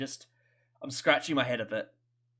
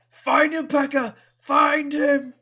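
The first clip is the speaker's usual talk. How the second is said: high, loud order; barked command; 12 semitones higher, 11 dB louder, slower than usual. Pretend. Fan mimicking a villain ordering his henchman, loud. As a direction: shout it like a commander.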